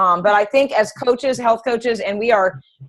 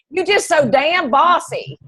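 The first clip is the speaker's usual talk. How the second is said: put-on higher, slow country-accent jab; country friend's blunt jab; 6 semitones higher, slower than usual. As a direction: drawl it out like her country best friend. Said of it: "Like that".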